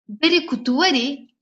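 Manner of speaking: ordinary talk, nothing marked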